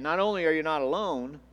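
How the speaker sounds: usual speaking voice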